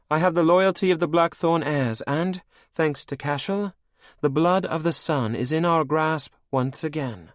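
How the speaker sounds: high, cold and mocking; villainess queen. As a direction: purr it over your captive like a cruel queen. Anne's proclamation.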